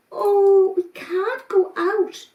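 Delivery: put-on high, slow hesitant little kids; small voices unsure about going out. Goat kids refuse the rabbits, high and hesitant.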